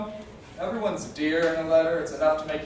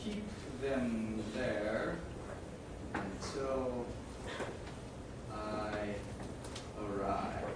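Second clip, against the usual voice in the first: very slow, soft, word by word; writing aloud; 4 semitones lower, 9 dB quieter, much slower than usual. Acted. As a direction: say each piece as the pen catches up, long pauses. I wanna write a note.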